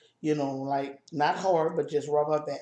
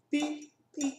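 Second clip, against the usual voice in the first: monitor beep; 10 semitones higher than usual. Retold or imitated. Imitating the monitor beeping.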